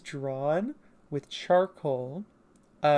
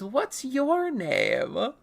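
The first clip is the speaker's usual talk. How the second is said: very slow, high, drawn-out question; acted question; 10 semitones higher, much slower than usual. Characters meeting for the first time, exaggerated question. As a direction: stretch every word, pitched up. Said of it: "He goes".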